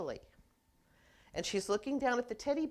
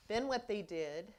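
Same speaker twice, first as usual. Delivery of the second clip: ordinary talk, nothing marked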